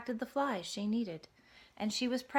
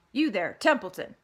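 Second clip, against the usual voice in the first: loud call across the yard; 8 dB louder than usual. Acted. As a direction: call the rat over. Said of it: "he called".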